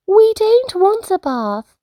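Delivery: high, cross little cub protesting; Fred refusing the bath in a huff; mock growl. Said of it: "growled Fred".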